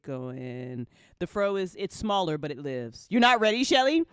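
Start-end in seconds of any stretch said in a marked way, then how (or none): 3.1-4.0 loud, higher playful tease; teasing call-out. Real, not acted. Host teasing Shelly on the stream, loud and playful. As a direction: call her out with a grin.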